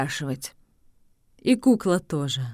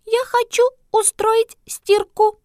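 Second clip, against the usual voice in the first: very high doll voice; squeaky toy doll calling Mama; talking doll; 4 dB louder than usual. Acted. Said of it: "кукольным голосом".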